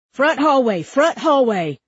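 high, loud repeated alert; urgent shout. A narrator's reading. Cop rushing in, urgent shout. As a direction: holler the location to backup. Second cop calling out position.

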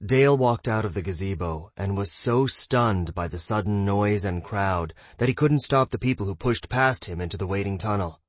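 usual speaking voice

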